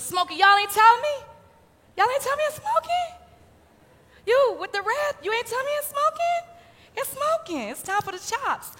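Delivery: high excited call to the grill. Real, not acted. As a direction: shout playfully at the smoking pan. Calling out to the grill smoke.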